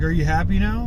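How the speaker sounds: ordinary talk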